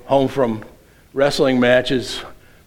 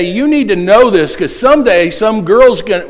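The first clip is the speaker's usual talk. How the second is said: raised, emphatic parental nagging; parental lecturing; 6 semitones higher, 5 dB louder than usual. Retold or imitated. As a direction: impress it on the kids, louder and higher. Parent lecturing sons, retold.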